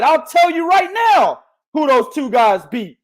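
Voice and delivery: ordinary talk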